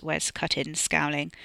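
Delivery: usual speaking voice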